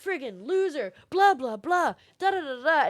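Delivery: loud, high, whiny jeer at a camper; mocking angry gamers. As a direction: sneer like a sore loser in the lobby. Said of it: "a couple people on the other team".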